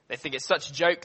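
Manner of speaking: ordinary talk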